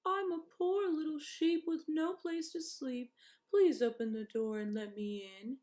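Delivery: high, sweet put-on sheep voice; wolf pretending to be a sheep; sweet little voice. A narrator's reading. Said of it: "in a sweet little voice".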